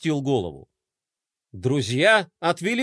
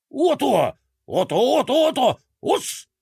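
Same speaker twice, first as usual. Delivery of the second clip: excited creature cries; 6 semitones higher than usual. A narrator's reading. Monster's excited rampage.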